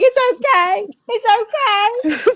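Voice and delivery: put-on very high, sing-out baby-soothing voice; cooing comfort. Grandma wrapping granddaughter in a towel, high cooing comfort. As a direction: coo it like comforting a crying toddler.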